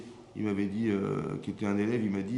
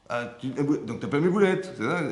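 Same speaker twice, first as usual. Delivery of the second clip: louder, higher hurt wife; offended wife; 5 semitones higher, 6 dB louder than usual. Retold or imitated. Wife offended her husband prefers his mother's meatballs, loud. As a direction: take it as an insult, loud and high.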